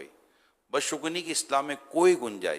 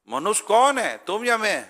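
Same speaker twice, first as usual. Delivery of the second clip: shouting even harder, much higher and louder; 10 semitones higher, 6 dB louder, slower than usual. Retold or imitated. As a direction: yell it defiantly across the crowd. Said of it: "اور زیادہ زور سے آواز لگائی".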